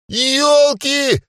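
very high startled cry; surprised yelp. Acted. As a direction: yelp as you fly up. Elf flung upward.

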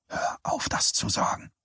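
a tired, pleading whisper. Acted. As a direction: breathe it out, no voice. Johnny pleads quietly on the phone. (müde geflüstert)

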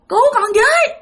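high-pitched desperate cry; shouting for help. Retold or imitated. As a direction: finally force out a cry for help. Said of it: "cố gắng bạn la lên được rằng". (cố hét lên cầu cứu, giọng cao)

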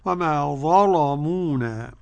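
"وما ظلمونا" chanted slowly, ل velarized; thickened lam. Shown as a demonstration. Teacher confirms for the student, heavy ل in "وما ظلمونا". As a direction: darken the ل of "ظلمونا", drawing it out. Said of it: "بتغليظ اللام".

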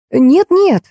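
mild, high-pitched yell. A narrator's reading. Woman protests into the phone, half-yelling. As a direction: cry out in urgent protest, pitch jumping up. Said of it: "заорала я".

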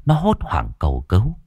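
plain reading voice

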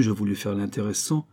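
usual speaking voice

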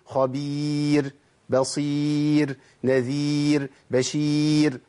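"خبير، بصير، نذير، بشير" with long held ee and a thin final ر; light ra. Shown as a demonstration. Teacher gives pupils examples of tarqiq, light ر closing "خبير، بصير، نذير، بشير". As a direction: chant each of "خبير، بصير، نذير، بشير" very slowly on a level pitch, ending on a light ر. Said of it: "الراء ترقق عندي على طول".

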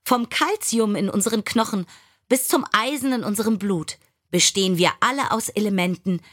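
ordinary delivery, nothing marked

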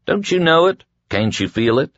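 high, insistent questions. A narrator's reading. Prisoner presses his guards to see it, insistent. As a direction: press them with urgent questions. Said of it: "Ralph insisted".

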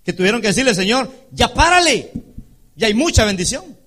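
high, loud fervent preaching; preaching at full force. Acted. Preacher telling congregation they'll beg God to stop, loud fervent. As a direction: cry it out to the church.

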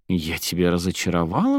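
ordinary delivery

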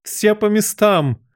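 very high, drawn-out command; thunderous shout. A narrator's reading. Queen summoning players, thunderous shout. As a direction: holler the order slowly to everyone. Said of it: "закричала королева громовым голосом".

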